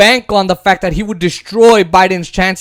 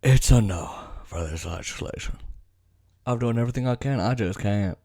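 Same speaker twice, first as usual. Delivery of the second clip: much lower, soft mocking impression of the senator; 10 semitones lower, 13 dB quieter than usual. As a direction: drop the voice way down and mock his refusal. Mocking Manchin's announcement.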